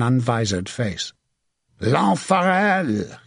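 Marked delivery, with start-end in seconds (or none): 1.8-3.2 high, slow, stretched-out name; war-cry shout. A narrator's reading. French knight telling the English whom they face, shouting. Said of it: "he shouted".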